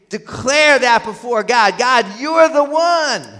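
high, loud declaration, slowing on the last phrase; loud proclamation. Acted. Worshipper declaring to God at the offering, loud and emphatic.

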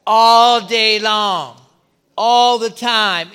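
loud, slow, ringing All day long; preaching climax. Acted. Pastor assuring congregation of protection, loud slow proclamation. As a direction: drive it home to the crowd.

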